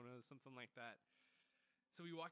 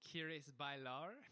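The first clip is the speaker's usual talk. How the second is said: put-on very slow, loud Spanish with a German-sounding accent; clumsy Spanish pickup line in a German accent; 7 dB louder, much slower than usual. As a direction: stiff German-accented "¿Quieres bailar?". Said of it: "Did it sound German".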